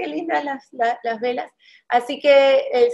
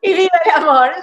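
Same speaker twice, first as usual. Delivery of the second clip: louder, joyful echo of the slogan; echoed cheer; 4 semitones higher, 6 dB louder than usual. Real, not acted. Host echoing her guest's cheer, excited call.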